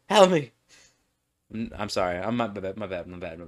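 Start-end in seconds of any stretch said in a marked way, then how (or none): 0.1-0.5 high loud mock cry for help; mock plea. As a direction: playfully beg for rescue. Losing track of the story.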